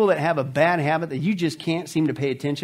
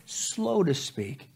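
slow and soft; 3 semitones lower, 9 dB quieter, much slower than usual. Shown as a demonstration. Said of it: "I gotta be quick to listen".

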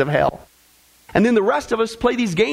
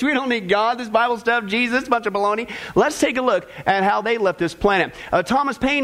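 ordinary delivery

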